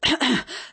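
a short throat clear. A narrator's reading. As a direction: clear the throat before speaking. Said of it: "He cleared his throat".

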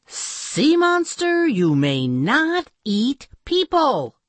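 high, slow, firm command; commanding heroine. A narrator's reading. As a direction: order the monster to stop. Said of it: "She said".

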